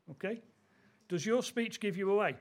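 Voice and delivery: ordinary talk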